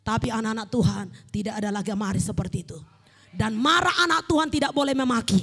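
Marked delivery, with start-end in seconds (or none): 3.4-5.4 emphatic, raised pulpit declaration; firm, ringing exhortation; forceful preaching. Real, not acted. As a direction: lay down the rule to the congregation.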